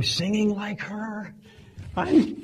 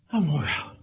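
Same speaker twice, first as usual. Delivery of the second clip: ordinary talk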